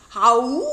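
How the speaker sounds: loud rising wolf howl; loud rising closing howl. A narrator's reading. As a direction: howl to end the tale.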